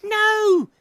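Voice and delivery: sudden high, loud cry; little owl's startled shout. A narrator's reading. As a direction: burst out in a defiant yell. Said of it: "he shouted".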